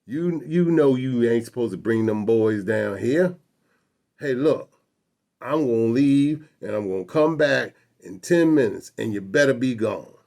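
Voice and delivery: loud Southern cop laying down an ultimatum; threatening policeman. Retold or imitated. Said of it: "he went on".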